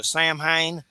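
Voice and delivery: SAM HAIN slowly, as two words, "sam-hayn"; drawn-out "Sam Hain", said as spelt; Georgia-style mispronunciation. Shown as a demonstration. Host from Georgia owning his way, "Sam Hain" as spelt. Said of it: "I'm from Georgia".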